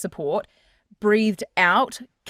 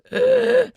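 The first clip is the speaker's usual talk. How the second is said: sharp high gasping in-breath; tight gasp; 5 dB louder than usual. Shown as a demonstration. Coach shows a tight-throated in-breath. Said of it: "just does an in breath and goes".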